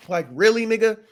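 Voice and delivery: really, you did that? said with disbelief; incredulous. Real, not acted. Viewer calling out the husband's useless text, incredulous. Mocking the character's text choice.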